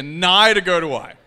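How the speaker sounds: loud, insistent protest; a fisherman loudly refusing; Peter protesting. Acted. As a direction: shout down the idea firmly.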